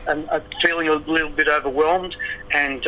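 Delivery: ordinary talk, nothing marked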